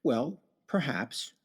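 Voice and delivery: ordinary talk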